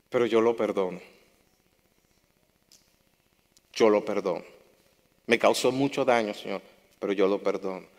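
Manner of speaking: a soft, sincere forgiving prayer; humble prayer. Retold or imitated. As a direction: pray it gently, letting go.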